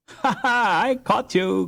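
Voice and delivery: a brother laughing as he catches his sister; laughing boast. A narrator's reading. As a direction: crow as you catch her. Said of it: "cried Hans".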